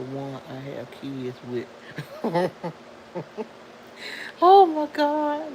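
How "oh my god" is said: "Oh, my God" drawn out slowly, louder and much higher; a long, high cry of surprise at the chat; amazed outburst. Real, not acted. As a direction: stretch it out in astonishment. Reacting to a viewer's birthday.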